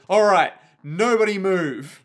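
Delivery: hold-up command. Acted. Robber orders everyone in the train carriage, commanding. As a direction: call it out to a whole carriage.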